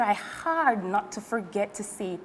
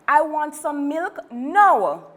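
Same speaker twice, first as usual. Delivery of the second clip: put-on louder, higher demanding child; whiny child demanding milk now; bratty demand; 5 semitones higher, 5 dB louder than usual. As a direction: insist like a spoiled kid.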